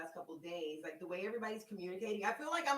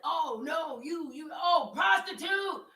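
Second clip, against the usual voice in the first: high, loud imitation of an indignant man; mock outraged shout; 8 semitones higher, 12 dB louder, much slower than usual. Retelling a man's reaction to being charged, mock outraged shouting. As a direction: cry out in put-on scandalized horror.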